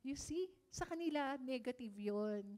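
normal talking voice

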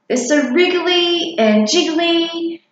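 a little monster grossed out by his food; disgusted monster child. Acted.

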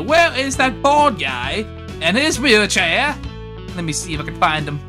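ordinary talk

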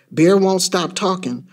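ordinary delivery, nothing marked

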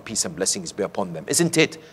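normal talking voice

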